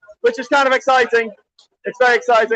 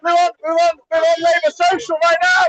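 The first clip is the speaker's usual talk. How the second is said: normal talking voice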